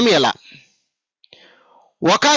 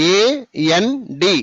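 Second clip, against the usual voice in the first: AND very slow, a gap between letters; divided letters; much slower than usual. Shown as a demonstration. Teacher showing pupils what not to do, AND slowly divided letter by letter. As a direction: break AND into drawn-out separate letters. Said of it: "ఇలా divide చేయకూడదు".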